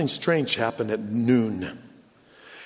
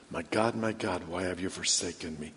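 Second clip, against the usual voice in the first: very soft, low, forsaken cry; quiet, grave and low; hushed lament; 8 semitones lower, 10 dB quieter than usual. Retold or imitated. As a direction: let the plea sink almost to nothing. Same cry repeated, hushed.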